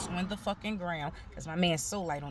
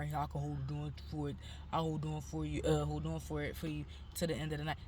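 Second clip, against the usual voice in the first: mimicking the guard; 4 semitones lower, 6 dB quieter than usual. Guard offers to hold knife.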